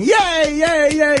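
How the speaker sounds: put-on high childish cheer; kid's high-pitched yay. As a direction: squeal it like a happy little boy. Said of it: "The kid's going".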